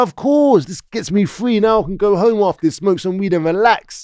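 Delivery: high-pitched, confident relief; eager. Retold or imitated. Suspect agreeing to police identity parade, eager and relieved. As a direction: jump at the offer, pitched well up.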